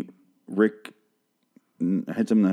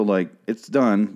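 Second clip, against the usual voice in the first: usual speaking voice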